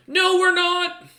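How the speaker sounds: very high, loud yell back; cheeky shouted reply. Acted. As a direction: shout back defiantly while fleeing. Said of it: "Little Scarf going".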